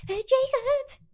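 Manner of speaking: high-pitched, hammed-up wail; mock sobbing plea. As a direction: cry out after him in fake despair. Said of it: "falls on her knees sobbing".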